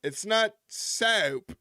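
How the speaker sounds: soap drawn out slow like "sap" in a mock Southern accent; Andy Kaufman's mock Southern soap. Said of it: "Kaufman's way of trying to sound Southern".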